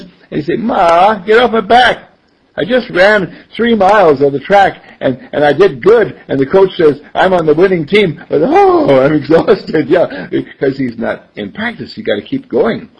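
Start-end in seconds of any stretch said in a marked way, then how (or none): 0.6-9.9 loud, raised-pitch protest to mom; acted worn-out kid complaining; exasperated teenage son. Acted exhausted teen protesting to mother.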